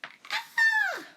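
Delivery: sudden high shriek of shock; a short piercing scream at the mirror; shrill startled "Ah!". A narrator's reading. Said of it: "Then she screamed".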